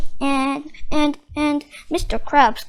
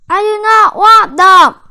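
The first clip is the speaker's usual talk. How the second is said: high, much louder shout from afar; yell; 6 semitones higher, 12 dB louder than usual. Acted. Squidward yelling from the crowd, refusing the nets. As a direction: refuse it at the top of your voice. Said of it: "yelled Squidward from the crowd".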